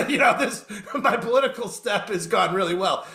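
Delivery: chuckling through an ironic aside; laughing. Real, not acted. Joking his political step went well, laughing. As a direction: let the laugh spill into the words.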